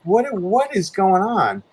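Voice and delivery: high, drawn-out bafflement; bewildered. Retold or imitated. Storyteller driven down a highway to who knows where, bewildered. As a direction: re-enact slow, stammered disbelief.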